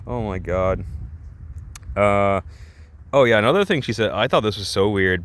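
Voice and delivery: ordinary delivery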